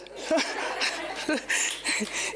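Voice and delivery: airy laugh falling away; soft unvoiced chuckle; breathy laugh. Real, not acted. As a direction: laugh off the boast. Laughs at own boast.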